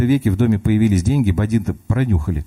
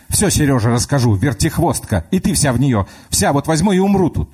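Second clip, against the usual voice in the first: higher, offended grandma threatening; indignant old woman; 7 semitones higher than usual. Acted. Grandma threatens to tell her son, indignant. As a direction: huff like a wronged granny.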